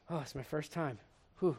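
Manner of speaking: a surgeon nervously confessing. Retold or imitated. Surgeon telling the patient, nervous and quiet. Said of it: "the surgeon looks at you and he goes".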